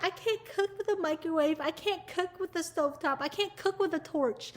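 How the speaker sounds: small, plaintive high voice, mocking; whiny mock complaint. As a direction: imitate a fussy chef complaining. Mimicking complaining chefs.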